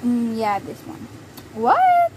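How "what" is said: high, loud startled what; jolted into a yelp; surprised what. Real, not acted. Sudden surprise in game.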